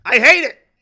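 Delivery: real, very loud burst of frustration; sudden exasperated shout; worked-up outburst. Real, not acted. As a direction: explode at full volume, venting. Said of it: "let me calm down".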